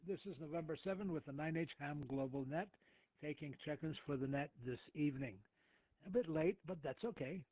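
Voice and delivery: normal talking voice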